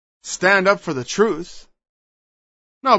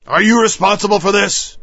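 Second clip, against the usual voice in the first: louder probing challenge; accusing question; 2 semitones higher, 5 dB louder than usual. Acted. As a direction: press him for an answer.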